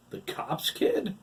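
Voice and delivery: high, slow dismay. A narrator's reading. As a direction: drag it out in dismay. Todd dismayed.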